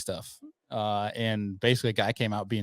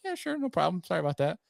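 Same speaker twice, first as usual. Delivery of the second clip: mock polite; 6 semitones higher than usual. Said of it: "if I'm having a good day".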